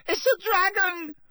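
very high, panicked squeal; loud first-year's cry of alarm; shriek. A narrator's reading. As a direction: shriek it, losing your head completely. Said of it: "shrieked one of the first years, losing her head completely".

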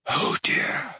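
whispered, unvoiced; breathed. A narrator's reading. Slughorn breathing his horror at Lily's death. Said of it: "breathed Slughorn".

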